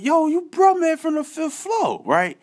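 very high, slow starstruck call-out; excited recognition. Retold or imitated. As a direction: squeal it like a fan meeting a star. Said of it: "So I'm like".

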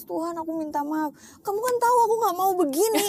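re-enacted crying plea, rising high; tearful prayer. Speaker retells last night's apology to God, crying. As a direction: plead to God in a mock wail. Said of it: "Aku nangis".

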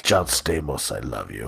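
very low, soft Russian voice; hushed adoration. Acted. Russian Kool-Aid confesses love to John Stamos, hushed and low. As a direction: drop right down, soft and deep.

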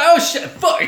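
loud, high mock outburst; hammed-up swearing reaction; mock exclamation. Co-host mimicking the crook dismissing Shorty, mock outburst.

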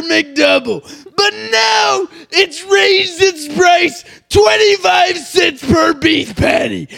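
high, loud hammed-up sales pitch; shouting pitchman. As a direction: yell like a crazed ad salesman. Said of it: "I'm spitting everywhere".

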